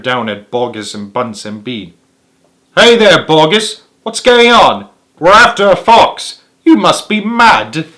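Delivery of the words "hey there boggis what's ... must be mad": higher, louder mocking calls; jeering crowd. A narrator's reading. Villagers jeer at Boggis, higher and louder. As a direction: heckle from the crater edge. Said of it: "The people jeered and laughed".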